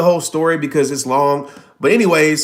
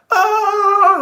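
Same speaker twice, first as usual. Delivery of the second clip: long, high mock scream dropping away; imitated falling scream; 3 dB louder than usual. Imitating the first jumper going out, high drawn-out yell. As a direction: scream like a skydiver leaving the plane.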